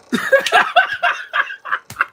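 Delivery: high breathless burst of laughter; real cackle at the screen; cracking up hard; loud laugh. Real, not acted. Laughing at the scene.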